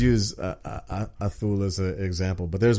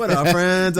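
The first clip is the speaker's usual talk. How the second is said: higher, louder mock Dominican greeting; lightly imitated Dominican accent; 8 semitones higher, 5 dB louder, slower than usual. Imitating Dominicans to a friend, Dominican accent. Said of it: "the way Dominican talk".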